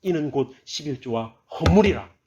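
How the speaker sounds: loud, high, indignant voice of God; wrathful scripture reading. Acted. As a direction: thunder the accusation as an angry God. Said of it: "하나님께서 이렇게 분노하시면서 말씀하시는 부분이 나와요".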